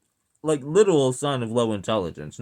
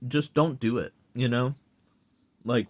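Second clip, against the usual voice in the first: normal talking voice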